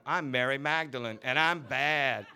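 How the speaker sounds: slow, saucy, laughing boast; brazen. Acted. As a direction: purr it like a bad girl. Carol's brazen stage line.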